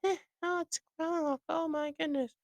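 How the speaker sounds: very high falsetto, softening off; put-on falsetto dismay; mocking detractors. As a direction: mimic fussy complainers in a squeaky voice. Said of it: "I know people are like".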